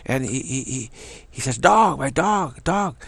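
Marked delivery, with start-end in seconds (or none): 1.6-3.0 high, slow, drawn-out mock call; imitated calling out. Man in wheelchair reporting lost dog, imitated calling. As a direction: mimic a man urgently calling about his dog.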